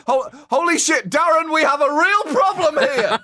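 high, loud alarmed gasp; suddenly panicked discovery; shocked. Pretend. Operator sees real mermaid.

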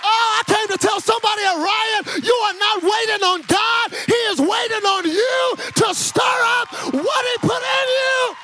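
high, shouted revival preaching; fervent pulpit cry, pitched high; full-force preaching. Real, not acted. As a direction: build to a rousing shout and rally the congregation.

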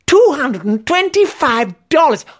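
high-pitched, rising disbelief; shocked exclamation. A narrator's reading. Narrator hearing the training cost, shocked exclamation. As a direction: balk at the price, voice leaping up. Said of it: "I exclaimed".

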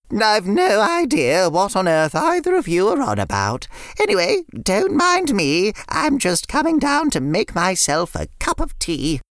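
put-on a fond nan greeting the kids; sweet old nan. Nan voiced.

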